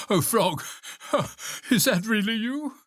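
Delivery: high, sighing relief; breath-broken high question after a nightmare; relieved disbelief. A narrator's reading. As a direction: gasp awake and ask in wonder. Toad wakes from a nightmare.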